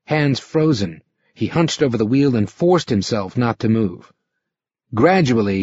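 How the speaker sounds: usual speaking voice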